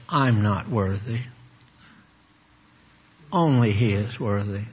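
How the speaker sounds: a hint of pious self-pity; mock humble. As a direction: sink down, roll the eyes, mock-meek. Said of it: "sink down in a real humble attitude".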